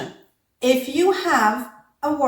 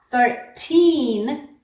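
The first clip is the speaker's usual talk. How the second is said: put-on "Thirteen" with a crisp t and long TEEN; second syllable stressed; 2 semitones higher than usual. Shown as a demonstration. Teacher comparing similar words, "Thirteen" stressed on TEEN, no flap. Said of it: "the second vowel is stressed".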